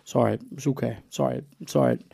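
mock-modest repeated brush-off; playing it down. Comic waving away a mate's praise, downplaying it. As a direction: imitate someone shrugging off praise. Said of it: "Playing it down".

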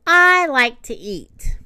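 loud, slow, savoured words; eager relish. A narrator's reading. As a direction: stretch it out with hungry pleasure. Puppy pleased about eating.

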